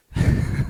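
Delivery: short winded laugh; breathless laugh. Real, not acted. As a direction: laugh while catching breath.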